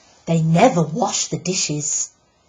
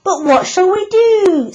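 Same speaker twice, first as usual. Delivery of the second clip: high, loud, lost voice of the pig father; helpless husband; 7 dB louder than usual. Acted. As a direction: wail the question in panic. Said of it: "said Mister Piggot".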